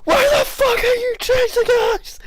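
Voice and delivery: high, screaming panic; panicked shout. Acted. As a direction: yell it while running away. Fleeing NPC screams.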